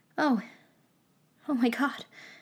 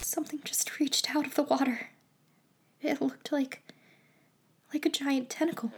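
hushed pretend terror, softer; fake fright; 3 semitones higher, 6 dB quieter than usual. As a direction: whisper it like a spooky story. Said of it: "just having some fun".

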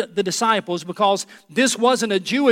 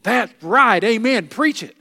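amen shout; 3 semitones higher, 6 dB louder, slower than usual. Retold or imitated. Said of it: "maybe they were saying".

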